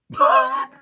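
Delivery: a sudden high, loud laugh; a real laugh breaking out mid-sentence; burst of laughter. Real, not acted. Laughing at the expensive bracelet.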